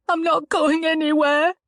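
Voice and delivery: cling on through sobs; sobbing. A narrator's reading. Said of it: "she sobbed".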